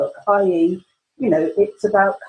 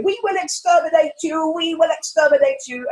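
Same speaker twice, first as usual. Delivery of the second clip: mock Dalek; 7 semitones higher than usual. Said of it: "pretending to be a Dalek".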